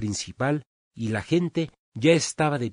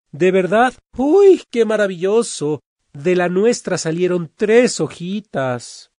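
high, loud gushing delight, the last line slower; excited villager; 7 semitones higher, 8 dB louder than usual. Acted. Villager bragging to a neighbour about three leaves, loud excited gushing. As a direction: brag with loud wide-eyed excitement.

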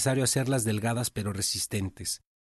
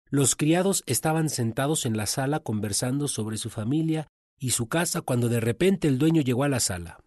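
ordinary talk, nothing marked

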